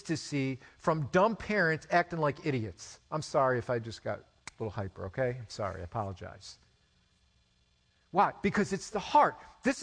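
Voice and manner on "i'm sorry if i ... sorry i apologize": low, quick, soft aside; quiet, hurried backing-down; sheepish apology. Real, not acted. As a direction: drop the voice to apologize. Sheepish apology for getting hyper.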